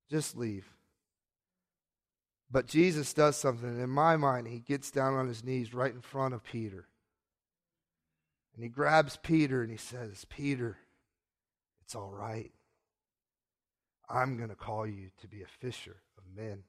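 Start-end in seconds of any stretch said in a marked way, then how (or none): 0.1-0.7 low, soft and defeated; acted dejected dismissal; ashamed plea. Peter tells Jesus to go. 10.3-16.6 low, hushed and tender; acted quiet, calming voice; gentle reassurance. Jesus reassures kneeling Peter.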